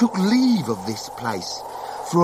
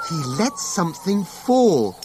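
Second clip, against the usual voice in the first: plain reading voice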